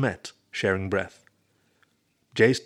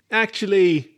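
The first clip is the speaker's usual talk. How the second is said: high, loud and grinning; 10 semitones higher, 7 dB louder, slower than usual. A narrator's reading. Roommate answers wolf's question about girls, grinning. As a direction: pipe up with a big grin. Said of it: "Ernie grinned".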